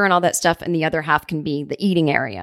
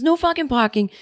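higher-pitched mock Boston grumble; Boston accent; 7 semitones higher than usual. Furniture guy griping about parking, Boston accent. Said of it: "I'm giving him a Boston".

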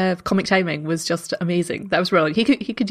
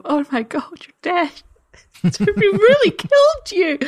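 shocked realization; 9 semitones higher than usual. Retold or imitated. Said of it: "the feeling of the lurching in the chest".

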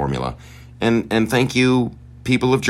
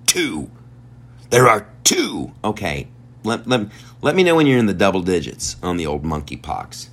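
normal talking voice

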